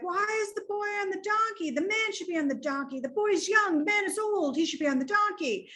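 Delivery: mocking onlookers. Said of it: "they're like".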